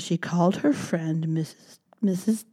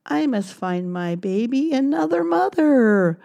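mother mouse; 6 semitones higher, 3 dB louder than usual. Acted. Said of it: "said Mother Mouse".

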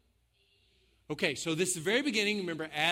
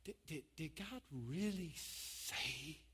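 very soft, slow, stammering question; hushed doubting voice; 3 semitones lower, 15 dB quieter, slower than usual. Acted. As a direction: stammer the doubt almost inaudibly. Tempter's doubt voiced again, haltingly.

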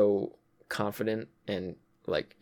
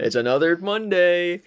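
higher, louder put-on sigh of a man stuck in routine; weary John voice; 8 semitones higher, 5 dB louder than usual. Host voicing John about his daily grind, mock weary. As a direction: play it like a tired worker facing another week.

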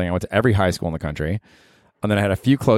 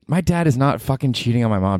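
ordinary delivery

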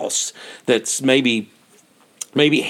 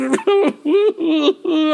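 high, wavering imitated sobs; crying like a little girl. Hiker mocking his own breakdown, imitated girlish crying. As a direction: let out a high, drawn-out boo-hoo wail. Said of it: "started crying like a little girl".

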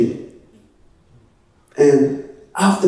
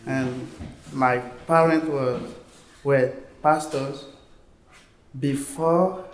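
French West African accent in English; ivorian accent; 5 dB quieter than usual. Real, not acted. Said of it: "I don't know English very well".

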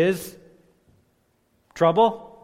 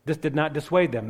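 usual speaking voice

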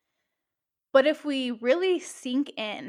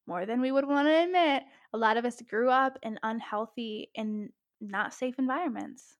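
ordinary talk

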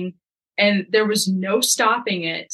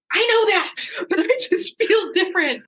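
pitched way up, half laughing, half crying; urgent high plea; 10 semitones higher than usual. Retold or imitated. Wife pleading with calm husband, high and strained. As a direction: insist desperately through the pain.

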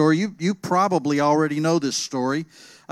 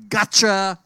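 loud triumphant yell; dinosaur yelling; 2 semitones higher, 6 dB louder than usual. Acted. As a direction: yell it like a pouncing monster. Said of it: "a dinosaur yelling".

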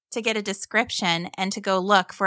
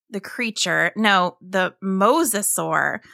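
ordinary delivery, nothing marked

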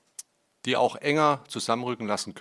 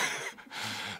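short rueful laugh; 6 dB quieter than usual. Real, not acted. Laugh after the ironic remark.

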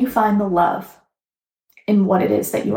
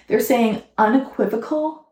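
ordinary delivery

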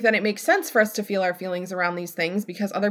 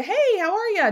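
breezy greeting; 11 semitones higher than usual. Retold or imitated. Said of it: "like a".

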